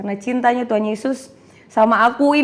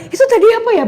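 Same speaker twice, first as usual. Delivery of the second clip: "Itu tadi apa ya?" asked much higher and louder, stunned; stunned surprise; 11 semitones higher, 6 dB louder, faster than usual. Retold or imitated. Back in her room after the vision, stunned. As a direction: gasp it out, amazed at what just happened. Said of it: "Kaget ya?".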